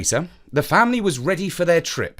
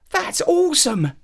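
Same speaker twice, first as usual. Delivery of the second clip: put-on excited boy; 3 dB louder than usual. A narrator's reading. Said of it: "Jeremy said".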